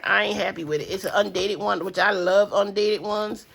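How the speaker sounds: usual speaking voice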